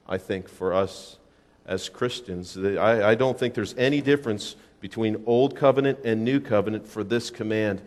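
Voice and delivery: ordinary talk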